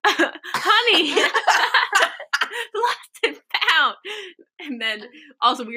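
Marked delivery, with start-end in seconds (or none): 0.1-3.9 high office-lady voice breaking into laughter; laughing imitation. A friend retells the main office lady's answer, giggling imitation. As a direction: draw it out, helpless with giggles.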